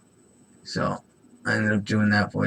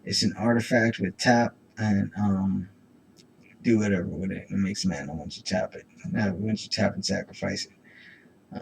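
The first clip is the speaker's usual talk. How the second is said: usual speaking voice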